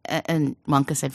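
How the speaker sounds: plain reading voice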